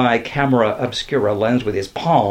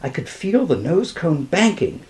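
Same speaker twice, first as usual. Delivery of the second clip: plain reading voice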